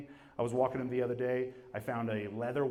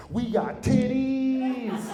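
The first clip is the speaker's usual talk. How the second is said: drawn-out glee; 10 semitones higher, 4 dB louder, much slower than usual. Retold or imitated. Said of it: "He was like".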